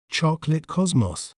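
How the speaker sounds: British pronunciation of "Chocolate cosmos", rounded short o in "cosmos"; British accent. Shown as a demonstration. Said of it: "In British accent".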